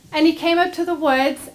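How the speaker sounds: usual speaking voice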